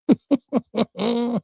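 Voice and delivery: high burst of laughter; short amused laugh. Real, not acted. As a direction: laugh out at the nasty work. Laughs at closeout remark.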